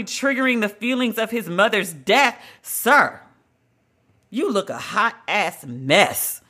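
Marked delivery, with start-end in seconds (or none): none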